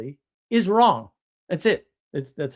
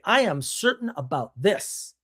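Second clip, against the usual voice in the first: certain declaration; 4 semitones higher than usual. Shown as a demonstration. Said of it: "You're willing to say".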